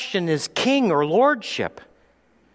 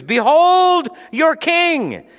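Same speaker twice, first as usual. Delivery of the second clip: slow, high ringing proclamation; proclaiming loudly; 12 semitones higher, 6 dB louder, slower than usual. Acted. Pilate shows Jesus to the crowd, loud slow proclamation.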